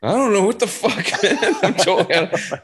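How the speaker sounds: high, laughing mock of himself; laughing panic. Lost tourist mocking his own confusion, high and laughing. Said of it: "So I was like".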